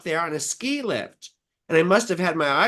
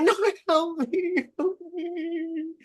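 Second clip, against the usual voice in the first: high-pitched pleading wail, fading off; mock crying; 12 semitones higher, slower than usual. Dreamer begging the dudes for help, mock crying. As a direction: plead in a squeaky pretend sob. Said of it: "I'm, like, crying".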